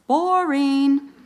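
Boring said high and loud, drawn out; emphatic boring. Shown as a demonstration. Preacher to listeners, mocking boring story, emphatic. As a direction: hit "Boring" hard and long. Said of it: "I'll tell you what it is".

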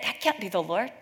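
ordinary talk

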